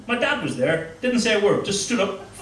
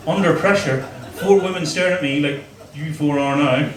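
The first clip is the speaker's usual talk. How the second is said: ordinary talk, nothing marked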